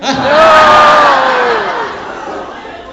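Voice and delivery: loud, high shriek of laughter; real startled laugh at a fan's cheeky offer; burst of laughter. Real, not acted. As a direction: caught off guard, explode laughing. Fan asks for a kiss.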